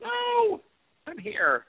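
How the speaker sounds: robotic breakup on a bad connection; garbled high-pitched digital distortion; Decepticon-like glitchy voice. Real, not acted. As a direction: voice warped by a faulty call. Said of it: "you sound like a Decepticon".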